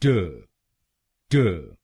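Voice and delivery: ट said alone as a short t sound; syllable demo. Shown as a demonstration. Teacher models the second syllable: isolated syllable. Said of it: "हम बोलेंगे".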